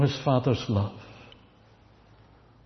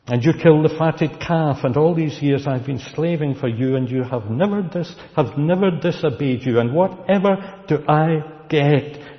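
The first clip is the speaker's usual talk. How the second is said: louder, higher, a resentful son spitting out his grievances; bitter angry complaint; 5 semitones higher, 5 dB louder than usual. Acted. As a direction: let the years of resentment burst out. Elder son's angry complaint.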